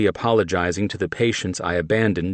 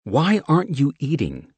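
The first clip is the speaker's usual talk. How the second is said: higher insistent question; pressing; 9 semitones higher than usual. A narrator's reading. Mickey pressing John about food, insistent. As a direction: push for an answer. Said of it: "she pressed".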